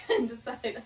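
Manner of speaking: ordinary talk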